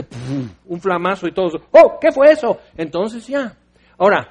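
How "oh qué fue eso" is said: loud high startled gasp; mock startled. Startled crowd reacts to God's fire, loud mock alarm. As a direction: jump at it like a sudden flash. Startled people after flash.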